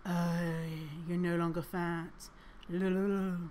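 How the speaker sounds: lightly mocking soothing voice; a hint of a dreamy sing-song hypnotist. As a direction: drone it like a lazy hypnotist. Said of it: "listening to someone go".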